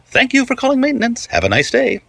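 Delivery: high sing-song customer-service sign-off; smooth, oily operator. A narrator's reading. Maintenance operator ending the call, smooth high sing-song. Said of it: "The voice was smooth and oily".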